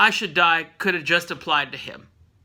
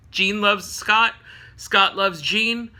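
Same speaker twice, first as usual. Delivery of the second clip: slow emphasis; slower than usual. Real, not acted. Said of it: "slow spelled-out emphasis".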